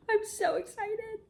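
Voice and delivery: high, slow and near tears; tearful excitement. Real, not acted. Reader overjoyed at the couple's confession, teary. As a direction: squeal it through happy tears.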